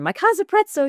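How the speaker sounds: bright mock-delighted exclaim; gleeful. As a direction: toss it out with cheerful disbelief. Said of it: "she said".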